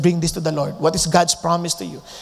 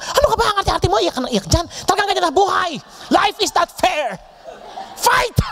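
much higher mock scold, ending in a shout of Fight; harsh scolding parent; 12 semitones higher, faster than usual. The wrong way to answer a crying child, high mocking scold. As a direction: caricature a parent brushing off tears.

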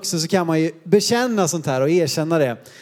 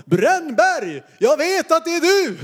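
rising high, retold shout into the dark; neighbours calling out; 9 semitones higher, slower than usual. Retold neighbours calling after boys hidden in the snow. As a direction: call out the name, sure you've caught them. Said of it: "Och så ropar de".

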